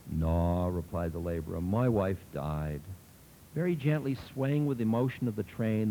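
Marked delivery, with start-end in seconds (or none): 0.1-2.8 slow, low, heavy with grief; grieving. A narrator's reading. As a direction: let the loss weigh every word. Drunk admits grief.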